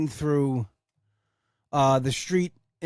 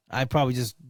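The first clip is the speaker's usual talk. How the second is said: ordinary delivery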